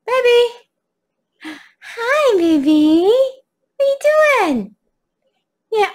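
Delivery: high, gushing voice for the dog. Real, not acted. Greeting her dog on camera.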